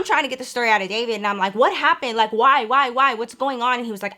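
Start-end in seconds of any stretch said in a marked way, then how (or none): 1.5-3.8 higher, insistent string of questions; retold worried questioning. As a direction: press for answers, mildly alarmed. Acted anxious questioning of boyfriend.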